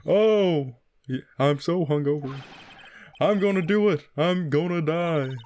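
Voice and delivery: wallow in hammed-up hungover misery; Kermit the Frog. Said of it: "almost like so, someone trying to do Kermit the Frog".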